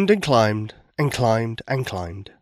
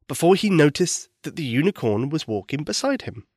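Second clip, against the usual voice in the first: ordinary delivery, nothing marked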